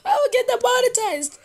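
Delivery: high laughing panic; half-screaming through laughter. Real, not acted.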